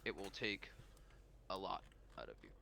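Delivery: low, soft, grave warning; Pirate King. Acted. Pirate King warning Daniel of the cost, low and hushed. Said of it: "and he says".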